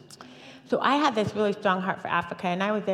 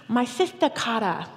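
imitated Ugandan accent, pitched higher; fond imitation of a Ugandan man's accent; 4 semitones higher than usual. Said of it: "he said it in a really great accent".

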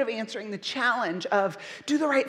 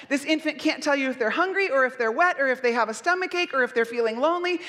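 usual speaking voice

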